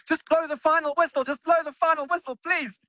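high, pleading mock prayer to the referee; tense pleading. Fan in the stadium begging the referee to end the match, high and pleading. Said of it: "we were all just sitting there going".

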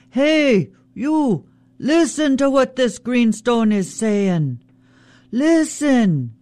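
high, carrying yell at the salmon; Raven yelling. Acted. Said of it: "Raven yelling at the salmon".

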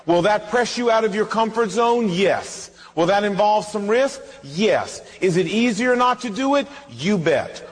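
usual speaking voice

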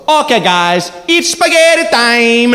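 put-on high, slow, shouted Italian Mario accent; high hammed-up Italian accent, shouted slowly. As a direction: belt it out like a jolly Italian host. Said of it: "puts down the menus and goes".

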